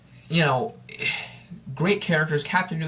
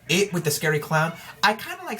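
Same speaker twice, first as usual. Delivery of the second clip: ordinary delivery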